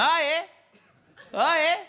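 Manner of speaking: very high, loud doubting question; mock Australian sceptic, pitched right up; exaggerated Aussie query; Aussie bloke. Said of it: "He went".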